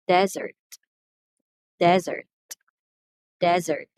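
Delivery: "desert" as DE-zert, first syllable stressed; noun stress. Shown as a demonstration. Teacher models the noun, "desert" stressed on first syllable. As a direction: hit the front of each "desert", pausing between. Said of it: "when we talk about the noun".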